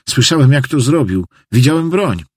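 high-pitched, insistent testimony; agitated janitor witness. Acted. (przejęty świadek, wysokim głosem)

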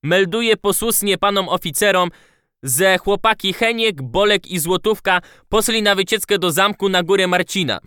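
put-on lisping boy, high and eager; eager little boy reporting with a lisp; childish lisp. A narrator's reading. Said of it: "Seplenił dziecinnie".

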